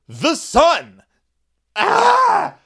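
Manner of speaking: high, loud hammed-up scream; mock horse panic scream. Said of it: "horses always just like".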